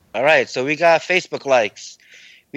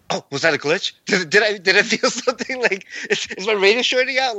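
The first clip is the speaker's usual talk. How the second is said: higher, worried self-quote; mock-panicked inner voice, pitched up; retold panic; 10 semitones higher than usual. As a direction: replay the moment you thought the radio failed. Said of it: "I was just freaking out".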